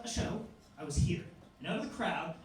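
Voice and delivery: ordinary delivery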